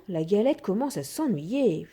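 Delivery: usual speaking voice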